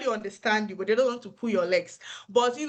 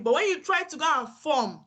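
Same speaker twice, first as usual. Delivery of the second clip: ordinary talk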